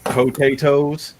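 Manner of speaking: slow Potatoes, every syllable spelled out; drawn-out potatoes. Shown as a demonstration. Showing the only right way, says Potatoes slowly. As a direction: stretch out Potatoes slowly and clearly. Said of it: "only way to say that word".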